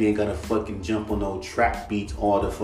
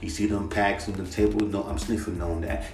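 mock a hard trap rapper; aggressive rapper parody; 2 semitones lower, 5 dB quieter than usual. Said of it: "These motherfuckers be like".

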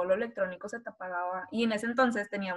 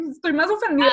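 indignant retort; 7 semitones higher, 10 dB louder than usual. Retold or imitated. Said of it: "y yo de que".

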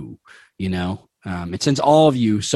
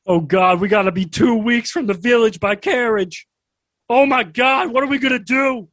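rising, higher mock panic; panicking Sullivan; 10 semitones higher than usual. Sullivan realizing they are lost, mock panic. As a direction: spiral into hammed-up panic. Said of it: "Sullivan's eyes go wide".

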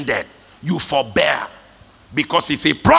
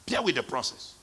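much softer echo; soft repeat; 3 semitones lower, 11 dB quieter than usual. Real, not acted. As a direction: drop it to a hush. Repeated softly.

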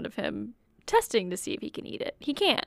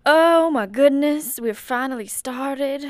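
excited; 5 semitones higher, 10 dB louder, slower than usual. Real, not acted. Relief after technical difficulties.

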